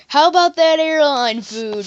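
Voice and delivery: loud, slow mock comedian delivery; stand-up opener. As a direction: belt out a hacky stand-up setup.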